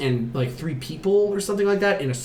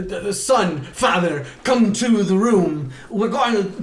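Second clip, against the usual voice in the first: halting, higher impression with odd pauses; Christopher Walken; 4 semitones higher, 3 dB louder than usual. Mother calling family to the seance, as Walken. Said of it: "I don't know why she's Christopher Walken".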